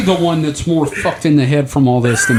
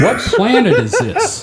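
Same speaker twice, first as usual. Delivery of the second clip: laughing; 8 semitones higher than usual. Real, not acted. Baffled by strange globe picture.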